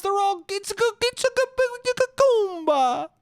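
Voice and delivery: very high put-on babble; mock Goomba frenzy. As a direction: spiral into high cartoon babble, dragging out the last word.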